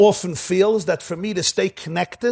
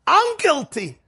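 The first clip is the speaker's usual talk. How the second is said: high, pained confession; Moshe pleading guilty; 11 semitones higher, slower than usual. Retold or imitated. Moshe admits guilt to the flock, high and pained. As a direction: cry out your guilt in a raised pitch. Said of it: "Moshe says this".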